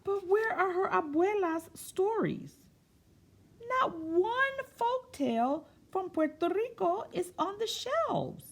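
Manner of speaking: high, wondering read-aloud; high, dramatic picture-book narration; storytime reading. A narrator's reading. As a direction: ask it to the kids with big wonder.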